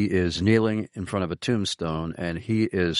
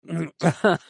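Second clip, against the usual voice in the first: a breathy wheezing laugh; real near-silent laughter; 9 semitones higher, 3 dB louder than usual. Real, not acted. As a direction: laugh with almost no voice. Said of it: "silent laughs".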